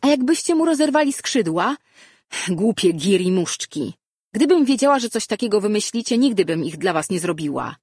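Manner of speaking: high, loud outburst easing off at the end; angry scolding. A narrator's reading. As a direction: tell the boys off heatedly. Girl scolds cousins after shouting.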